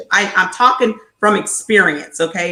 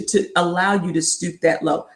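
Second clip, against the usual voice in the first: usual speaking voice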